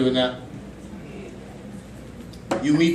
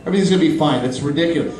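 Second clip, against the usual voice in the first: ordinary delivery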